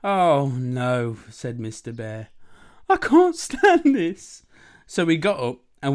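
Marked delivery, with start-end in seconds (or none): 0.0-1.2 loud, drawn-out groan; put-upon bear dismay; exasperated bear. Acted. Mister Bear kept awake at dawn. 2.9-4.4 very high, loud, slow wail; drawn-out acted despair; exasperated bear. Mister Bear at breaking point.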